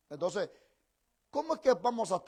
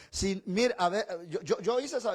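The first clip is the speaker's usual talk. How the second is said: usual speaking voice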